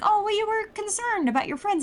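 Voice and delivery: high sly Garros. Retold or imitated. Garros the ghost voiced.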